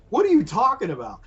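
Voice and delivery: high, loud disbelieving demand; incredulous boss. Retold or imitated. Boss to the worker claiming he was hit, incredulous. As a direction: voice the boss's exasperated disbelief.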